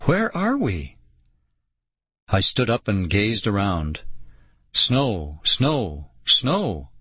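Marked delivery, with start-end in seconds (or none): 0.0-1.0 high, small worried question. A narrator's reading. Girl realises they are lost, small-voiced. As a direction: ask it high, like a lost child. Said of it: "said in a small voice".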